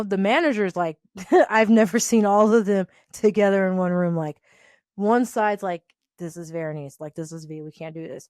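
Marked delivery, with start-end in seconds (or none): 6.2-8.3 much softer, murmured aside; hushed retelling. Retold or imitated. Managers whisper about firing V, hushed. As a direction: coworkers murmur among themselves, hushed and soft.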